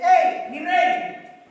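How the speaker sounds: impassioned cry up to the king; David shouting. Retold or imitated. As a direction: call out to the king across the distance, pleading. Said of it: "David le grita y le dice".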